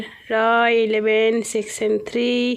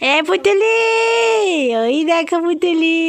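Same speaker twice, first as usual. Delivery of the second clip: put-on baby talk; 4 semitones higher, 4 dB louder, slower than usual. Calling to a baby cousin.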